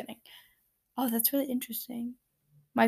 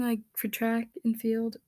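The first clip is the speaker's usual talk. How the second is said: normal talking voice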